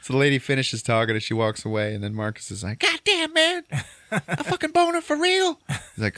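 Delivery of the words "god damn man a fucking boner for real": much higher and louder, squealing disbelief; squealing mock shock. Marcus freaking out over his boner, squealing mock shock. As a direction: shriek it in hammed-up alarm.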